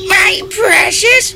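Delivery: high raspy slow creature hiss; Gollum voice. Acted. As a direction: croak it like Gollum. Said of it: "that's not even a fucking Marvel movie".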